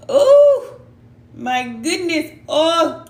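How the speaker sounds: real shock at the Ten of Swords card; surprised exclaim. Real, not acted. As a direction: gasp out loud at the card.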